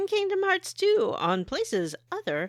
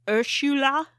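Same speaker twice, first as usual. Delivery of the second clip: higher, mock New Zealand accent on "Ursula"; 4 semitones higher, 3 dB louder than usual. Shown as a demonstration. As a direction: say "Ursula" as in a Kiwi advert, hit too hard. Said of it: "they like to say".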